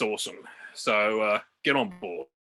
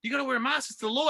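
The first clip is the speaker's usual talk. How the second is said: high-pitched panicky telling-off; freaking-out cafe owner; 8 semitones higher than usual. Retold or imitated. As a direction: imitate an owner freaking out over a rule. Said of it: "the owner starts freaking out".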